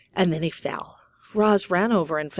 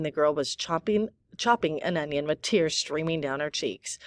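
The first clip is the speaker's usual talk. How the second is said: ordinary delivery, nothing marked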